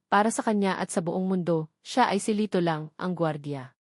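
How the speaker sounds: ordinary delivery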